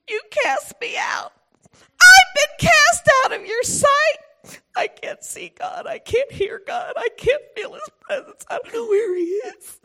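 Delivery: high, loud, anguished pleading with God; anguished Jonah. Acted. Jonah pleading with God, high anguished cry. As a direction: cry out like a man cast away, close to tears.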